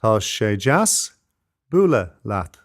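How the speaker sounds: slow, careful model of Tá sé deas bualadh leat for learners; slow Irish demo. Shown as a demonstration. Teacher slows the phrase down for beginners, slow and careful. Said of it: "Listen again".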